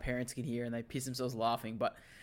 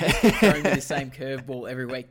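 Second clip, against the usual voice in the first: laughing; 9 dB louder than usual. Real, not acted. Teasing banter, laughing.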